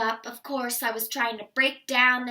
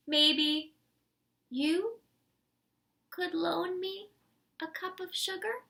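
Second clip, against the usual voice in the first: higher, softening hopeful plea; sly ask; 6 semitones higher, 3 dB quieter than usual. Acted. Wolf slyly asking listener for sugar. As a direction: ask sweetly and sheepishly.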